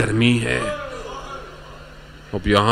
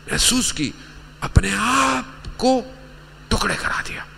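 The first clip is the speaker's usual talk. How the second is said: tearful lament; 13 semitones higher, 3 dB quieter than usual. Real, not acted. Preacher mourning those cut to pieces at Karbala, tearful. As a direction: let grief break into the voice.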